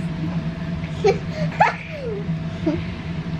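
giggle. Real, not acted. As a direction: burst into a squealing giggle. Child giggling after answer.

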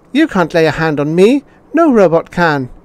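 put-on high, loud child's refusal; a spoilt boy digging in his heels; mayor's son shouting back at the robot; defiant boy.

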